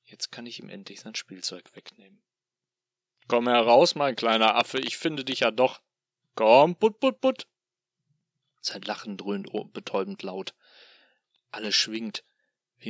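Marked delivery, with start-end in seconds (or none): 3.3-7.5 loud, high, singsong demon calling out; taunting demon call. Acted. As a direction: coax him out like luring a pet. Demon calls the hiding man.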